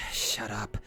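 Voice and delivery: hissed whisper under her breath; seething whisper to herself; angry whisper. Acted. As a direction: whisper it through clenched fury. Character seething at insults.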